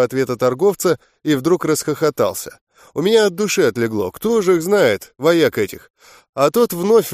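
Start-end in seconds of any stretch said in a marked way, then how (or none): none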